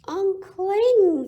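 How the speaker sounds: loud, high crowd call. Acted. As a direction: call up to the tree, urging. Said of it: "the crowd called".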